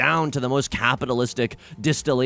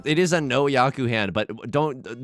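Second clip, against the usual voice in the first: ordinary delivery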